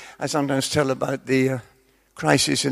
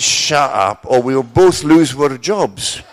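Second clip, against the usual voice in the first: low, loud, slow hint of a lion's voice; slightly furry voice; 3 semitones lower, 7 dB louder, much slower than usual. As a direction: growl it like a lion in a suit, slowly. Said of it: "a slightly furry voice".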